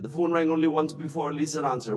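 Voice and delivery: usual speaking voice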